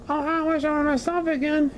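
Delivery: put-on high, pleading child's voice; a trapped donkey longing to be himself; young donkey voice. A narrator's reading. Sylvester as a rock, wishing hopelessly. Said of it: "he thought".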